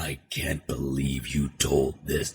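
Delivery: low, slow villain voice. Acted. Actor showing a raw villain read, low and slow villain. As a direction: drop it low and draw it out. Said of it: "But with a villain".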